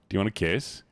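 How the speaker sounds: ordinary talk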